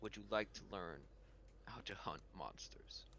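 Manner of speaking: low, hushed, grave offer; Pirate King. Acted. Pirate King offering to teach Daniel, low and hushed. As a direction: drop low and soft, nearly whispering at the end. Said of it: "he turns to you and he says".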